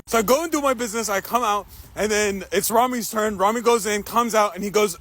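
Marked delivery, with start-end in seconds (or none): none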